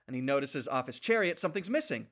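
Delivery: ordinary talk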